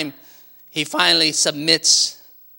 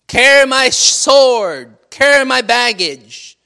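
slow, loud and higher, a Roman soldier's order; barking soldier; 7 semitones higher, 9 dB louder, much slower than usual. Retold or imitated. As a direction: bark the order down at him like a soldier.

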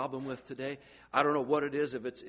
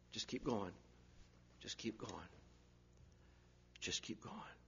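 softer each time, ending in a whisper; fading to whisper; 6 semitones lower, 18 dB quieter than usual. Shown as a demonstration. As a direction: let it die away.

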